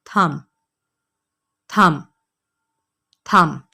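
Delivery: थंब with no b sound at the end, "thum"; थंब repeated, b left silent. Shown as a demonstration. Teacher drilling thumb for students. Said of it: "आपने कहना है".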